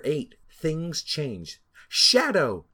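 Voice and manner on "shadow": loud called name; shout. Acted. Sonic spotting Shadow, shouting his name. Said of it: "Sonic shouted".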